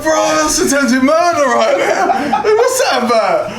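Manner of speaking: very high, loud comic protest; high, indignant mock yelling; retold outraged shout. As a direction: re-enact a panicked complaint at full pitch. Acted protest after near-fatal throw.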